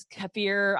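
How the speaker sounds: "kefir" said as keh-FEER; alternative pronunciation. Shown as a demonstration. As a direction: end "kefir" on a long feer. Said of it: "I've heard it pronounced so many different ways".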